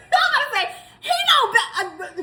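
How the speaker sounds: high, fast words broken by laughter; can't hold the laugh back while telling it; laughing through words. Real, not acted. Telling viewers the story, laughing mid-sentence.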